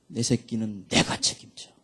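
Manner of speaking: low, settled promise from God; reassuring voice of God. Retold or imitated. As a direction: drop low and take charge, fatherly. God's reassuring answer after shouting.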